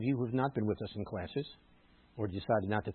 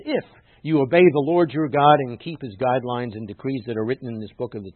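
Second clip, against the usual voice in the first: loud, declaimed verse; strong public reading of scripture; emphatic scripture reading; 2 semitones higher, 10 dB louder than usual. Acted. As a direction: proclaim the text with force. Reads scripture aloud, emphatically.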